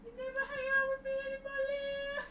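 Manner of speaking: high, quick, plaintive whine; whiny complaint. Pretend. Housemate moaning to streamer about being ignored, whiny.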